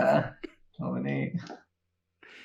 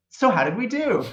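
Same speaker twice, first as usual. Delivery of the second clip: perky robot; 8 semitones higher than usual. Acted. Robot snaps back, asks the party.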